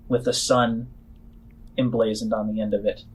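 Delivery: ordinary talk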